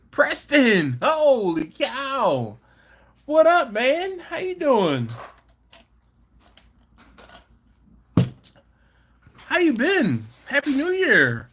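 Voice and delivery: high, loud happy hello; excited greeting. Real, not acted. Greeting Preston in the chat, high excited hello.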